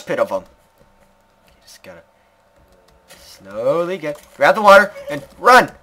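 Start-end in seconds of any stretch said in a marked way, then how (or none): none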